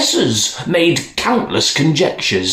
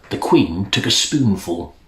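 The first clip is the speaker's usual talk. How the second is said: plain reading voice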